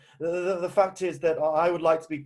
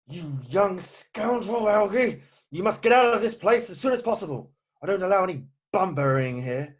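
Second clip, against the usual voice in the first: high-pitched, indignant outburst; angry scolding; 4 semitones higher than usual. Acted. Jack orders Algy out of the house, angry scolding.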